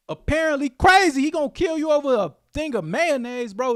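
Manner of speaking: loud, high-pitched disbelief; incredulous outburst. Real, not acted. Host on a killing over mayonnaise, incredulous outburst. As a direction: burst out high and loud, amazed.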